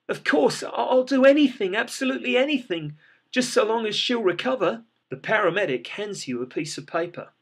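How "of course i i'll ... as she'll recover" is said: desperate eagerness. Acted. Desperate owner begging paramedic.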